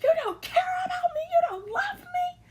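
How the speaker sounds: imitating Carly's teary meltdown, shouting and sobbing high; mock crying outburst. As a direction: wail it like a hammed-up breakdown. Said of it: "Carly's crackhead genes was kicking in".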